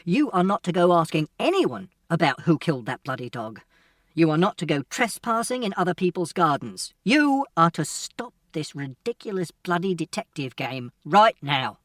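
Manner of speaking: loud, building angry tirade; father scolding his son loudly for the last time; furious father. Acted. Father's final furious warning.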